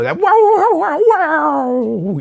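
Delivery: high wavering wow-wow guitar dive; whammy bar imitation. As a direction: mimic a whammy bar guitar wail with your voice. Imitating a Floyd Rose whammy.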